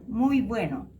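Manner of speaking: usual speaking voice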